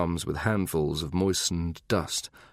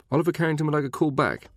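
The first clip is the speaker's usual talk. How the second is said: faster and higher, pressing the name Oliver; insistent repeat; 9 semitones higher, faster than usual. Acted. Secretary repeating the message to her boss, insistent. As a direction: push through it, refusing to be brushed off.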